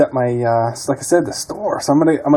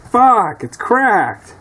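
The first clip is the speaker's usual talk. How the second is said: angry dismay; 9 semitones higher, much slower than usual. Real, not acted. Discovers cracked casing.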